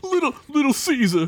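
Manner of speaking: high, strained call to son; coughing Joey. Acted. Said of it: "Joey coughed".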